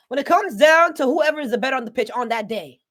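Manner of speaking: rising to a loud, heated claim; emphatic argument. Real, not acted. Emphatic build in a debate.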